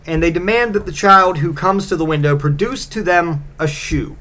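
normal talking voice

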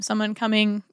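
ordinary talk

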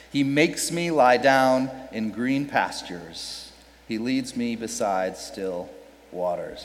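slow, fading to soft at the end; slow reading trailing off softly; slow scripture reading. Shown as a demonstration. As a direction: read it slowly and let it fade.

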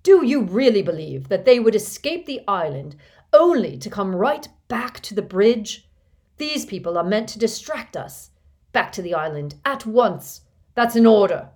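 loud, high villain's tirade; villain roaring orders at recruiters; terrible voice. A narrator's reading. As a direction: berate them and snap out the order. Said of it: "in his most terrible voice".

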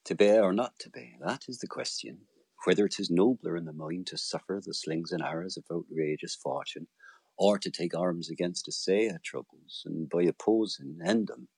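lightly voiced Shakespearean original pronunciation; elizabethan english. Shown as a demonstration. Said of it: "Something like this".

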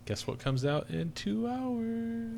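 high, slow, drawn-out teasing question; teasing singsong. Real, not acted. Co-host teases the film coming out soon, slow singsong. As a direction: stretch it out and climb high in anticipation.

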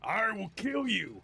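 high, snarled monster threat; angry goblin threat. Acted.

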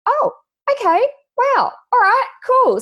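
very high-pitched, surprised reaction; taken aback. Retold or imitated. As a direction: react high, thrown by sudden gushing. Acting taken aback by gushing client.

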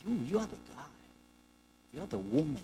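soft, doting self-praise; self-admiring king. Acted. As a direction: murmur compliments to yourself. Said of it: "while he's on this monologue by himself".

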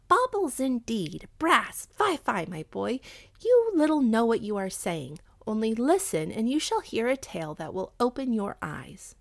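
mock-scold the boy, then draw him in; animated mother's rebuke; indignant mother. Mother scolds son in tale.

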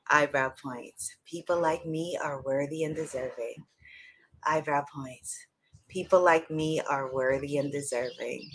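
low, calm affirmation voice; guided tapping affirmations. Acted. Leads a tapping affirmation round.

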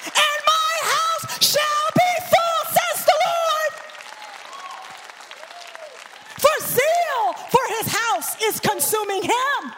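sung-out preaching climax; preaching at full force. Acted. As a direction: proclaim it like a prophet over the crowd.

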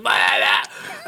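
slow unvoiced cartoon blah; breathy mock death gurgle; South Park death noise, slowed down. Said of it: "he makes the fucking South Park".

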